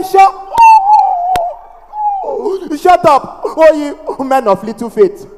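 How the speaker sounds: hammed-up stammering retort; angry stammer. Seller snapping back at the heckler, angry mock stammer. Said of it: "they easily get angry".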